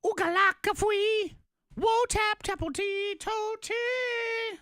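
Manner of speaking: squeaky high gibberish; high cartoonish nonsense chant; silly high voice. Gibberish sing-song noises.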